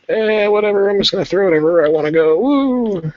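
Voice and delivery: high, fast, carefree squeal; careless whatever voice. Retold or imitated. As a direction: toss it off like you don't care. Careless painter mocked.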